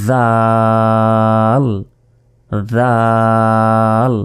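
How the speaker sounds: দাল as a long "dhaaal", voiced th as in "this"; tongue-out th. Shown as a demonstration. Teacher demonstrating with tongue out, drawn-out th. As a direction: put tongue between teeth and stretch দাল. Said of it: "জিহ্বা না বের করলে কিন্তু".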